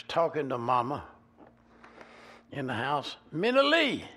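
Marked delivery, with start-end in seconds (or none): 3.3-4.0 a loud, high call of "Minnie Lee". Retold or imitated. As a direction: call her name up and loud from the yard. Pee Wee calling mama outside.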